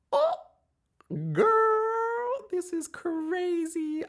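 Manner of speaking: high squealing delight; excited squeal. Real, not acted. Overjoyed squeal at the read.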